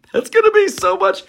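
a tiny squeal, very high; shrill mock squeal of delight; squeaky high voice. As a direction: squeak it out like a cartoon.

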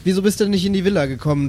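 normal talking voice

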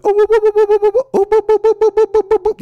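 very high, loud pulsing whoops; rapid sonar-ring hooting, way up high; Aquaman radar whoops. Acted. Host imitating fish radar rings, loud whooping. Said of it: "Aquaman's gotta go like".

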